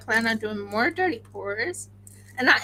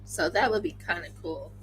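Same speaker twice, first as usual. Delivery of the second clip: ordinary talk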